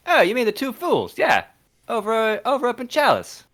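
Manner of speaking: high Cockney urchin voice; pleading Cockney street kid; old london cockney. Acted. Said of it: "Cockney boy".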